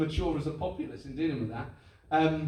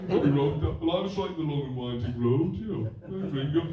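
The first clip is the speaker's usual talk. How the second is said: put-on old Liverpool accent; 3 semitones lower than usual. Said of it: "lovely deep like that".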